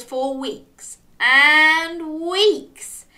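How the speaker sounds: "and" stretched long, high and loud; drawn-out AND. A narrator's reading. Telling how long Dog and Crab travelled, "and" drawn out long. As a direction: stretch "and" to make the weeks endless.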